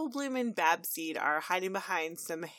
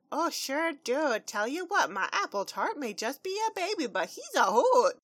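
high country voice; 7 semitones higher than usual. Acted. Pony mom answering neighbour.

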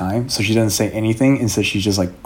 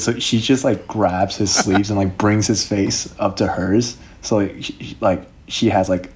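ordinary talk

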